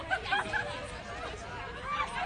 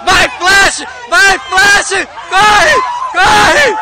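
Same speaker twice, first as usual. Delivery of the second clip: shouting; 6 semitones higher, 8 dB louder, much slower than usual. Real, not acted. Cheering runner before gate closes.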